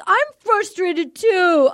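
high, drawn-out mock frustration; mock half-yell. Contestant answering her frustration, imitated half-yell. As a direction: imitate his half-hearted yell, slowly. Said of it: "kinda yell".

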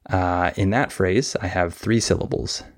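usual speaking voice

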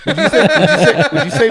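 high, loud excited burst; excited squeal. Real, not acted. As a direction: blurt it out, way up high. Asks question excitedly.

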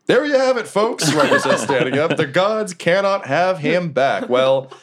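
big, lilting announcer delivery; Remy. Acted. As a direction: address the crowd as the fight's emcee. Remy says, standing up.

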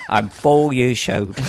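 put-on slow Cockney drawl for a small boy; Michael Caine. Four-year-old answering dad, Michael Caine voice. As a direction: draw it out in an old Cockney actor's voice. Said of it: "Michael Caine".

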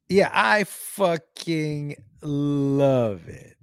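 very slow, drawn-out relish; slow, emphatic delight; savouring it. Real, not acted. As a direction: stretch each word out with relish. Savouring his joy at trolling.

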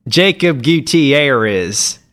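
slow, drawn-out Texan accent, mangling the name; slow Texas twang on a sign-off. Shown as a demonstration. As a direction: say the name wrong like a West Texan would. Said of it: "in your Texan".

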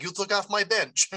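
put-on high-pitched, jokey Ukrainian accent. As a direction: play a Ukrainian player in a Ukrainian accent. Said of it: "That was my best Ukrainian accent".

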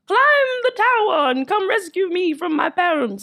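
high, slow, tearful damsel plea; Rapunzel pleading. Acted. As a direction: beg from the tower, near crying. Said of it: "Sounds like Rapunzel".

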